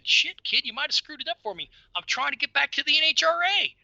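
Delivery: high-pitched retold complaint; mock exasperation. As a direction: imitate griping at a kid who messed up. Retold friend complaining to the boy.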